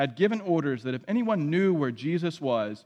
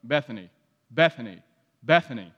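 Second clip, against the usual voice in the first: a mock-wistful lament on Bethany; wistful intoned sigh; 6 semitones lower than usual. Shown as a demonstration. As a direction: sigh it out, sinking lower each time. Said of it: "Every time you hear that word, say".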